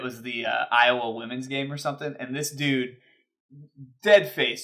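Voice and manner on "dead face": ordinary talk, nothing marked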